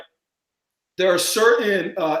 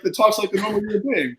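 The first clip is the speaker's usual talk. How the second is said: usual speaking voice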